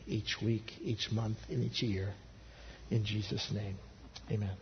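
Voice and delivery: low, soft, measured prayer; reverent, hushed petition ending in amen; closing prayer. Real, not acted. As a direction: pray quietly, pausing between phrases.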